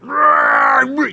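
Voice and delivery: high, strained roar; growling strongman roar. Pretend. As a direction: roar like you're wrestling the wheel. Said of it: "you're like".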